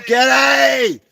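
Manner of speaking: loud high delighted yell; excited shout. Real, not acted. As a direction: shout it out in joyful disbelief. Excited at caller appearing on live.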